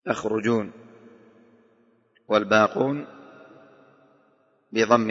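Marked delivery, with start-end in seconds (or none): 0.1-0.7 "تخرجون" as takhrujun; fatha ta, damma ra. Shown as a demonstration. Teacher shows Hamza's reading, "تخرجون" pronounced takhrujun. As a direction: open ta with a, then u on the ra in "تخرجون". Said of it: "بفتح التاء وضم".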